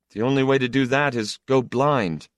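son's retort. A narrator's reading. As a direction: throw it back before walking out. Son retorts and walks out.

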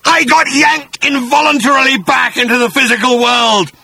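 high, loud brooding monster voice; a beast nursing his grudge; vengeful bat-creature. Acted. Agrajag continues his story.

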